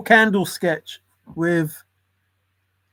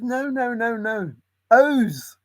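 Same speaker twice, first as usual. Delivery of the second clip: exasperated customer; 7 semitones higher, slower than usual. Retold or imitated. Customer correcting in sketch.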